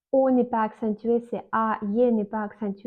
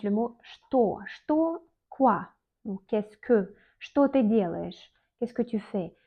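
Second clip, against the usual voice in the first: usual speaking voice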